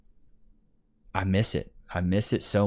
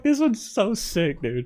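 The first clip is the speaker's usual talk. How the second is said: high, slow delighted quote; high delighted This one's so sick; excited; 12 semitones higher, slower than usual. Retold or imitated. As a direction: gush about it. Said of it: "I was like".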